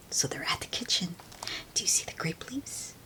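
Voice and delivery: soft unvoiced aside to listeners; whisper. Real, not acted. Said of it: "aside to children, whispered".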